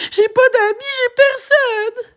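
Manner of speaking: high, slow, sobbing words; crying. Real, not acted. Streamer crying to chat that she has no friends. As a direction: let it break into a sob. Crying about loneliness on live.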